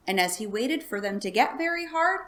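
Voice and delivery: ordinary delivery, nothing marked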